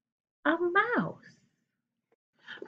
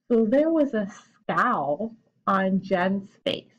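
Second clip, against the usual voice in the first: slow read-aloud; slower than usual. Shown as a demonstration. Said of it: "teacher reads sentence slowly".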